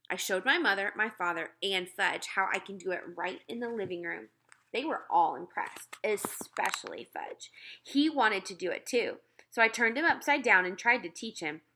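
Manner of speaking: ordinary delivery, nothing marked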